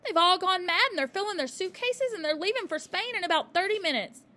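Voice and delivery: high loud rushed alarm; a girl pouring out the news; panicked child. A narrator's reading. Matilda panicked, out of breath.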